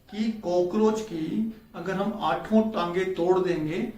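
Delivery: usual speaking voice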